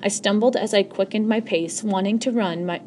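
usual speaking voice

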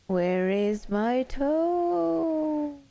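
high climbing wail, very drawn out; groaning ghostly voice. A narrator's reading. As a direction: let the last word swell upward, long and eerie. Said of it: "the voice groaned".

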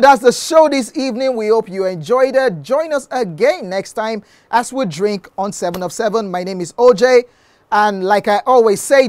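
loud, high show-host announcement; announcer voice. Acted. Host announcing the end of the session to viewers.

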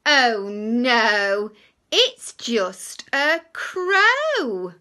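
loud, slow twist; loud reveal. Acted. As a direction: sing out the twist. Relieved reveal in the story.

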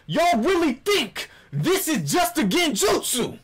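comic fake fury, shouted; mock outrage. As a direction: yell at the audience for doubting you.

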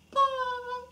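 very high, tiny squeak; little squeaky voice. Pretend. Waving off the children, in a little squeaky voice. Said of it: "that's a little squeaky voice".

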